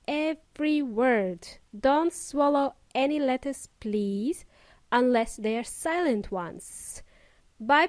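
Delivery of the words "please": ordinary talk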